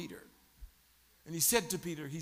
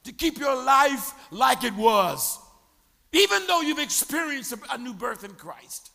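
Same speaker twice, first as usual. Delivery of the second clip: loud, higher, driving sermon questions; full-force preaching; 5 semitones higher, 7 dB louder than usual. Acted. Pastor presses the congregation, loud rising preaching. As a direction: shout the challenge at the congregation.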